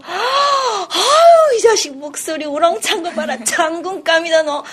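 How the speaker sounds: high, loud, drawn-out doting mother; doting mother praising her loud baby, high and laughing; delighted mother. Acted. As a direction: gush over the baby, laughing.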